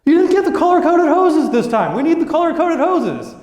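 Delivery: new guy insisting the boss ordered wrong; frustrated new guy. Retold or imitated. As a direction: insist hard, exasperated. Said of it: "He was like".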